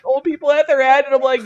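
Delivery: ordinary delivery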